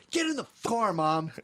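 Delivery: high-pitched mock yell at mom; bratty son yelling. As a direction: imitate a kid ordering his mother around. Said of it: "literally towards the end, he goes".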